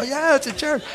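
high-pitched eager answer; excited confirmation. Retold or imitated. Pastor tells stranger the nightclub is a church, excited.

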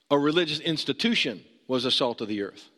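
usual speaking voice

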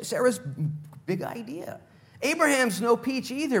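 ordinary delivery

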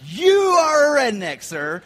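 drawn-out loud proclamation; triumphant verdict. Retold or imitated. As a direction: declare it like a verdict. Friends' final verdict.

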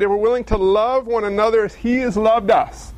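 ordinary delivery

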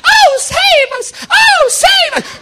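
loud, very high, drawn-out Southern wail; hammed-up Southern damsel shrieking; southern accent. Lady begging to be rescued, mock Southern wail. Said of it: "That's my southern accent".

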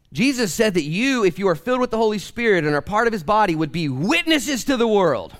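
high, loud pulpit preaching; fiery preacher driving the point home; full-force preaching. Acted. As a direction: preach it out to the back row.

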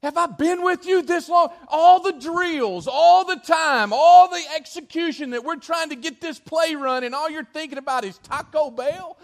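high, loud, incredulous coach shouting; exasperated coach yelling. Retold or imitated. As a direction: rant at the player who wrecked the play. Coach yelling at lollygagging point guard.

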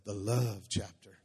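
deep, loud, drawn-out "love"; mock romantic voice. Shown as a demonstration. Preacher hamming up a wedding passage, deep and slow. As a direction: croon it low and slow, stretching LOVE. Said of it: "you gotta say it like that".

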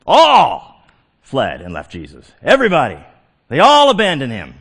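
ALL held long and punched as the big word; stress on ALL. Shown as a demonstration. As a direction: hammer the word All, then They all. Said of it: "this is the big word of this verse".

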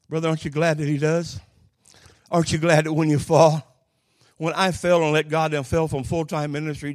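loud, rising preacher appeal; preaching. Acted. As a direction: call out to the congregation.

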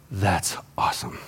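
low, hushed wonder; awed kid. Retold or imitated. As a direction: breathe it out like a kid amazed in class. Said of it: "And I went".

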